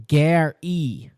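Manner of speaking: Gary drawn out slowly as "Gair-ee"; phonetic pronunciation. Shown as a demonstration. Co-host reading a profile, Gary sounded out as "Gair-ee". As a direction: split Gary into two slow parts, "Gair" and "ee". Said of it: "phonetic spelling, how to say his name".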